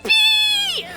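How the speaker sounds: high loud yelp of joy; gleeful cheer. Acted. Nina cheering at joining the team, high and gleeful. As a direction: squeal it like a delighted kid.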